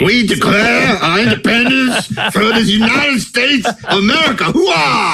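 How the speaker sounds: high, slow, far-off Creole accent impression; Pacino's crazy Creole accent, shouted. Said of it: "he does this crazy, like, Creole accent".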